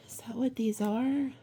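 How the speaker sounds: breathless whisper of realization; awed whisper. Real, not acted. Reader whispering to viewer in sudden awe. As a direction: whisper it as the meaning dawns on you.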